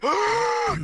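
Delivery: sharp, high imitated gasp; gasp for air. Speaker acts out waking in his body, gasping for air. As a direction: suck in one desperate breath. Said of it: "gasping for air going".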